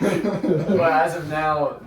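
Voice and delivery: laughing through the words; cracking up mid-sentence. Real, not acted. Host laughing mid-intro.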